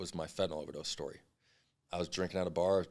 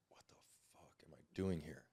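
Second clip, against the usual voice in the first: a dazed, breathless whisper to himself; confused whisper; 3 semitones lower, 11 dB quieter than usual. Retold or imitated. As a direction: whisper it, lost and bewildered. Waking confused in the ambulance.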